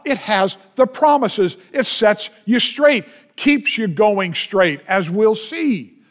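normal talking voice

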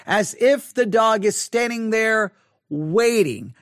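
normal talking voice

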